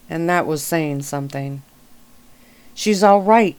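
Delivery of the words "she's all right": louder, higher call of relief; a raised yell that she's all right. Acted. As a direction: shout it out to the others. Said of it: "he yelled".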